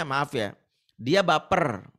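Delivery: ordinary delivery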